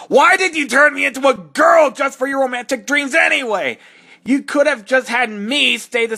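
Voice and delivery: high, loud indignant yell; exasperated fan-voice outburst; outraged shout. Acted. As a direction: shout it at the author in disbelief. Character ranting at fanfic author.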